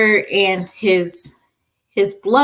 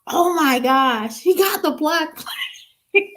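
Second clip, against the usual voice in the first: laughing mock panic; 9 semitones higher, slower than usual. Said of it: "I was like".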